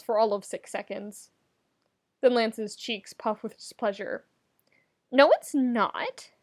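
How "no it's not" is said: slow, higher petulant no; whiny, drawn-out denial; sulky denial. Acted. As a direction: deny it like a pouting kid. Lance denies the teddy bear.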